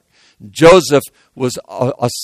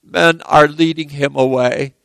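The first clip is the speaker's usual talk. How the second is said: ordinary talk, nothing marked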